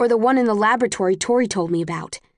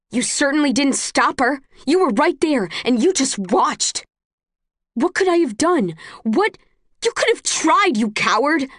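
high, loud desperate screaming at her brother; 7 semitones higher than usual. A narrator's reading. Tris accuses Caleb of watching her torture, screaming. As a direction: scream it through tears of rage. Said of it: "I scream so loud my face gets hot".

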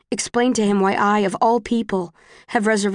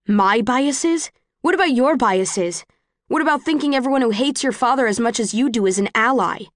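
raised, indignant counterattack; angry; 4 semitones higher than usual. Acted. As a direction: throw it back, higher. Quarrel on the train.

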